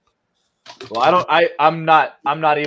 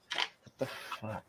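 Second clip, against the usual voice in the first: whispered; 3 semitones lower, 15 dB quieter than usual. Real, not acted. Forehead in hands, muttering.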